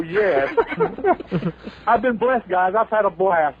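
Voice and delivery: normal talking voice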